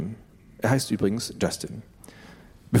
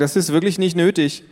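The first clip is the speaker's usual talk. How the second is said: embarrassed protest; 8 semitones higher, 4 dB louder than usual. Acted. Annoyed teen to parents.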